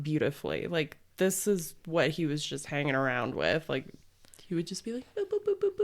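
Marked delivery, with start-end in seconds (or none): 5.1-5.8 mock lyre plucking. Imitating someone playing the lyre.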